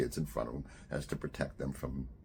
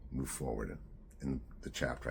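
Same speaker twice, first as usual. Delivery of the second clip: ordinary delivery, nothing marked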